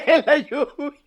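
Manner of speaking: slow, laughing repeat; laughing echo of the cue. Real, not acted. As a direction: chuckle out the words.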